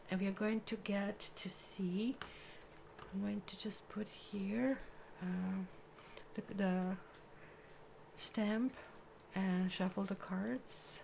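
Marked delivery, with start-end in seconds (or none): 0.1-6.5 soft unvoiced whisper. Real, not acted. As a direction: breathe the words out quietly. Quiet aside during card reading.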